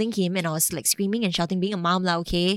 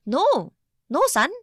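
higher, louder acted parent; mock-firm parent voice; parent correcting son; 8 semitones higher, 6 dB louder than usual. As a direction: insist like a parent setting a child straight.

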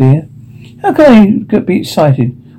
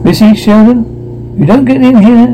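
yelling through a door; 7 semitones higher than usual. Acted. Sister yells at her brother through the door. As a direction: shout at the sibling hogging the room.

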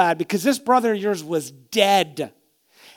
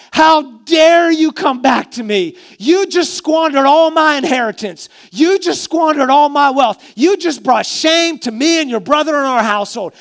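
loud, higher, furious rebuke; a father's outraged tirade, raised high; angry father; 7 semitones higher, 7 dB louder than usual. Pretend. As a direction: scold the son who shamed you. Father's imagined rebuke of prodigal son.

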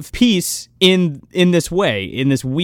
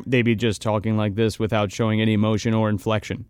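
flat monotone robot voice, lower and softer; 7 semitones lower, 5 dB quieter, faster than usual. Shown as a demonstration. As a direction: talk like a robot with no inflection. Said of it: "speaking like a fucking robot".

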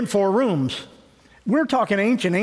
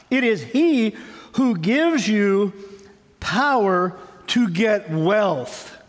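solemn slow warning; much slower than usual. Retold or imitated.

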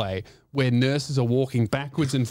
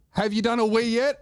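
higher, lightly imitated nurse's nagging; a hint of the impatient nurse, pitched up; nurse checking in; 7 semitones higher than usual. Nurse asking the patient again, mildly imitated higher voice. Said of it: "he goes".

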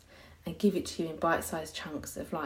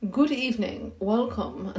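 higher, louder, formal presenter greeting; professional host voice; 4 semitones higher, 5 dB louder, slower than usual. Shown as a demonstration. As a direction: put on a polished, formal host voice. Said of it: "Should I be more professional?".

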